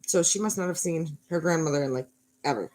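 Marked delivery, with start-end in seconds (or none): none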